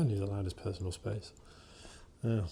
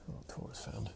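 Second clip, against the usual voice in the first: whisper; 11 dB quieter than usual. Real, not acted. Aside while working with dog.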